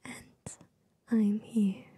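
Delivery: normal talking voice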